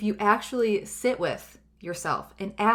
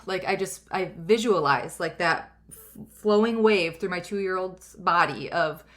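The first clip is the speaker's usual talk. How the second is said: ordinary talk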